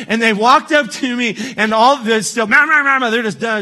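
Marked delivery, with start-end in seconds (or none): 2.5-3.1 mimicked meh meh babble; mock ranting. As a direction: parody the caller still ranting. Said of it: "just ranting and raving".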